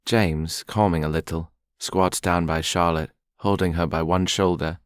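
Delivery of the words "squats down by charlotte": usual speaking voice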